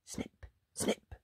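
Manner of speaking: unvoiced whispered snip. A narrator's reading. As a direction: whisper the snips. Scissors cut the hair.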